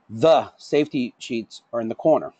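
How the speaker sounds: "The" set apart with a pause after it; stress on THE. Shown as a demonstration. Trainer showing how emphasis changes a sentence, stress on "The". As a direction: lean hard on the opening word "The". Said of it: "changing the emphasis on the words".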